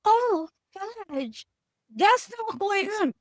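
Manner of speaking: mock-prim, high voice; prim client. A client offended by swearing, lightly mocked prim and high. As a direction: play the pearl-clutching client lightly. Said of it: "Be like".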